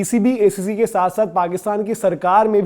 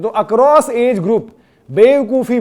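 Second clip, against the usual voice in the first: across age group dragged very slowly, then बेवकूफ़ी hit higher and louder; slow emphatic build; 4 semitones higher, 4 dB louder, much slower than usual. Acted. Vlogger mocking rivals, slow then punched बेवकूफ़ी. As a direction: stretch it out, then slam बेवकूफ़ी. Vlogger scolding Pakistani officials' foolishness.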